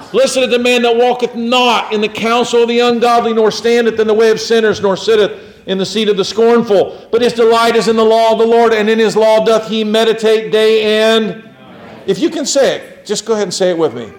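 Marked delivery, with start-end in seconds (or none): 0.1-11.4 high, emphatic preacher's recitation; scripture recitation. Acted. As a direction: declaim the verse to the congregation. Recites psalm to congregation.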